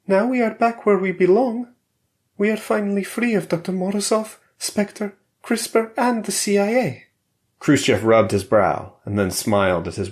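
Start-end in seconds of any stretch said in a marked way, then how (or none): 0.1-7.0 higher, relieved wife; Valeria's voice. A narrator's reading. Wife tells her husband they are free, higher and relieved. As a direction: let the relief of freedom through.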